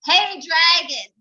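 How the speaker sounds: loud, slow "Hey, dragon"; shout. Acted. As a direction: shout to wake him. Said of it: "She shouted".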